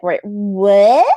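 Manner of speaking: mock disbelief, rising sharply on WHAT; a stunned double take, "what" swooping up; incredulous. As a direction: act out baffled disbelief. Reacting to the husband's suggestion.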